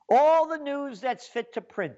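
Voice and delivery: the newspaper's slogan, proud and high; mock grand slogan. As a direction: announce it like a lofty motto. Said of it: "that's their quote".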